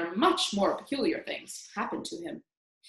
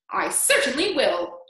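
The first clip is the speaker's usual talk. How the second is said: loud, high, sharp retort; irritated, raised-voice reply; snapping; 6 semitones higher, 6 dB louder than usual. A narrator's reading. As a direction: snap back crossly. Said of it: "Aunt Spiker snapped".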